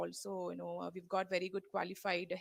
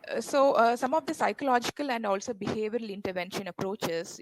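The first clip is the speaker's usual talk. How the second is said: louder; 3 semitones higher, 12 dB louder than usual. Real, not acted. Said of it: "Little, little louder would be good".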